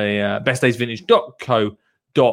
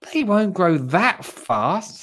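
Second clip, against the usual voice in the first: put-on higher, slow, drawn-out child voice; child character retort; 6 semitones higher, slower than usual. One child corrects the other, higher drawn-out character voice. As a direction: stretch it out in a raised child's voice.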